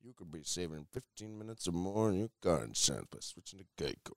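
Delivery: low, soft, solemn pitchman; serious ad voice. Retold or imitated. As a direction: deliver it like a grave spokesman. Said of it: "that serious guy who walks out and he's like".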